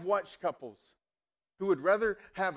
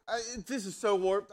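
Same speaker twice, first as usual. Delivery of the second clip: ordinary talk, nothing marked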